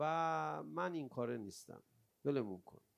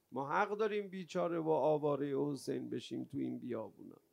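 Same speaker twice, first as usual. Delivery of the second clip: normal talking voice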